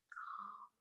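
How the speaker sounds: the last calm fading to almost nothing. Retold or imitated. Chaplain's final soothing word to the dog, barely audible. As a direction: let the word die away gently.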